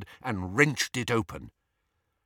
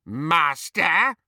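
croaking elf voice, higher and rising at the end; bullfrog croak; 6 semitones higher, 4 dB louder than usual. A narrator's reading. House elf answering Harry's summons, croaking. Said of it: "croaked Creature in his bullfrog's voice".

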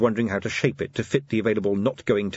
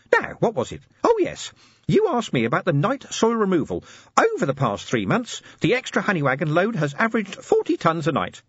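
high, chirpy little assistant; squeaky helpful gadget voice; tiny imp voice; 10 semitones higher than usual. Acted. Imp reports.

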